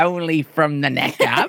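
laughing, lightly mocking British accent. Actor teasing his costar, echoing her British accent. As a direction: repeat the line in a half-laughing British accent. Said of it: "Was that a British accent?".

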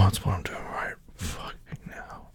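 very soft, strained whisper; hushed and urgent; breathless whisper. Acted. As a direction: push the words out on a breath. Breathless intimate talk to partner.

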